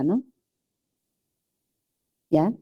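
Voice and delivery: ordinary talk, nothing marked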